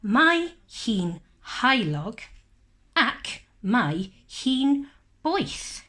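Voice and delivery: slow and clear, word by word; slow read-along. Shown as a demonstration. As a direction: read slowly for children to follow. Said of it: "Darllenwch gyda fi".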